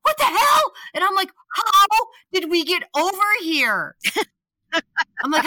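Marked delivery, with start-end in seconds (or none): 0.0-0.7 high, loud mock shock; startled outburst. Retells friend's shock at wrong lane. 1.5-4.0 high, loud retold disbelief; bewildered. Retells bewilderment at wrong lane.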